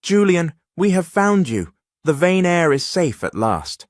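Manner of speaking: high, loud tearful cry; sobbing. Acted. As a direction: cry out in dramatic grief. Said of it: "she sobbed".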